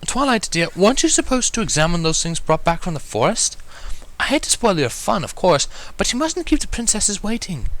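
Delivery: high, prim and proper lady voice; a refined lady gently reminding; high, very proper ladylike tone; proper Rarity voice. A narrator's reading. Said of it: "in a rather profoundly proper tone".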